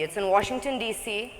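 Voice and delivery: usual speaking voice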